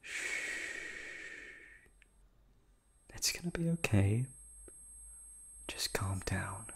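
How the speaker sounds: soft whispered shushing, slow; sinister lullaby whisper; creepy hush. Acted. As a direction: lull the captive to sleep. Hushing thrashing captive.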